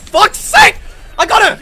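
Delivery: loud, high outburst; frustrated shout. Real, not acted. Frustrated outburst during game.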